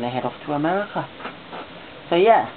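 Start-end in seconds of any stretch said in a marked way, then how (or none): none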